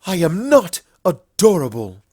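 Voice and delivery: loud, stubborn denial; indignant insistence. Acted. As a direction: stamp the words down, offended. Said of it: "Luna stomped a hoof ... insisted".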